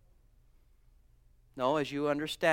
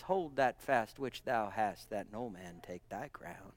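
solemn scripture; 7 semitones lower, 7 dB quieter than usual. Acted.

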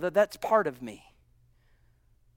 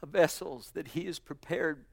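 soft reassurance; 4 dB quieter than usual. Acted. Soft closing to the church.

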